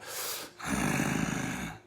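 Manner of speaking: a very low growling exhale; imitated deep growl breath. The unknown caller growls down the line, deep breath. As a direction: rumble out a breath like a demon. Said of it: "a deep voice and that grunt".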